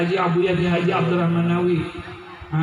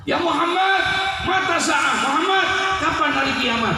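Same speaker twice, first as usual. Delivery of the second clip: high-pitched, insistent call; imitated shout; 12 semitones higher than usual. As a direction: mimic the villager demanding an answer from across the room. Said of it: "tiba-tiba teriak".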